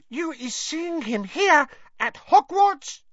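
high slow amazed elf voice; breathless elf. A narrator's reading. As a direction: awestruck house-elf. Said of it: "said Winky breathlessly".

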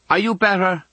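put-on high-pitched girl calling out; girl's voice. A narrator's reading. Girl calling to unseen patient next door, high girlish voice. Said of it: "a girl's voice says".

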